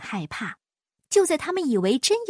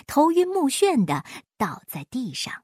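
ordinary delivery, nothing marked

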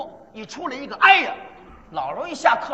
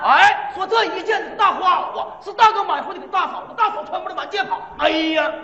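偏高偏快的叫卖，末尾突然一声"哎呀"; 越唱越快，最后猛喊"哎呀"吓人; 东北吆喝; 5 semitones higher than usual. Pretend. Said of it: "东北故意，看着啊". (东北小贩吆喝，末尾一声惊叫"哎呀")

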